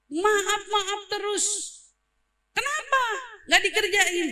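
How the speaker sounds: high-pitched, exasperated mock scold; angry scolding. Boss scolding a worker who keeps apologizing, high and exasperated. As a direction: snap back at the endless apologies.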